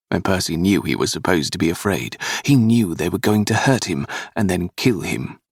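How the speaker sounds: plain reading voice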